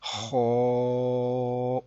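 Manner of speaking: खौ held long with a full, rounded "aw" vowel; long, steady, heavy खौ; heavy letter. Shown as a demonstration. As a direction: draw खौ out, thick and full-mouthed. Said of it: "इसको हम पुर पढ़ेंगे".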